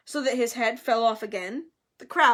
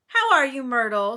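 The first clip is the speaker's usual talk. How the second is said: falsely bright; 7 dB louder than usual. A narrator's reading. Hermione greeting Myrtle, falsely bright. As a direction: greet with too much cheer. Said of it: "in a falsely bright voice".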